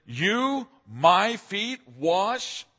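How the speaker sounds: slow, high astonished question in Peter's voice; gobsmacked. Retold or imitated. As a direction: drag it out, stunned and appalled. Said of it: "he's gobsmacked and a little appalled".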